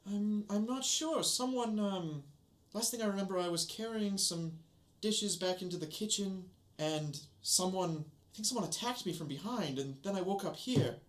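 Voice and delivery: a hint of a dazed, then cross maid; servant girl voice.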